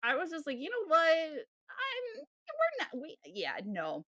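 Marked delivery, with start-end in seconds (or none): 0.6-3.2 much higher, sputtering with exasperation; fed up and tripping over the words; exasperated. Retold or imitated. Exasperated reply to pushy guy.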